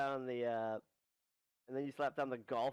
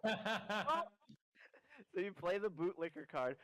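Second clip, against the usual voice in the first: ordinary talk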